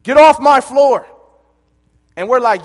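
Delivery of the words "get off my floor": high and loud, angrier repeat; louder drill instructor yell. Retold or imitated. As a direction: yell it louder the second time.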